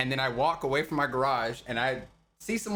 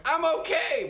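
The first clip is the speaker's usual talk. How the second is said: much higher, louder half-mock plea; muffled cry for help; 13 semitones higher, 3 dB louder than usual. Drunk at the party, trying to reassure everyone, mock shouted plea. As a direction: yell it as if behind thick glass. Banging on glass wall unheard.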